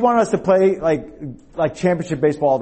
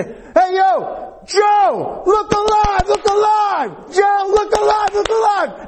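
full-throated, very high shout across the field; imitating a coach at full yell; slower than usual. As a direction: holler with drawn-out, repeated urgency.

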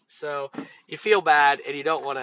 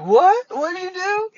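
high, loud, incredulous demand; alarmed question; 7 dB louder than usual. Retold or imitated. Worker comes out of the car to Thompson, loudly alarmed. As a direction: re-enact a startled shout at a coworker.

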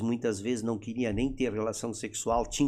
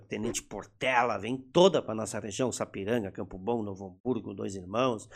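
usual speaking voice

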